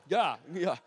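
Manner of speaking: usual speaking voice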